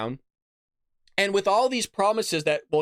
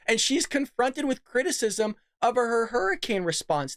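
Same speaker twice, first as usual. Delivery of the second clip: usual speaking voice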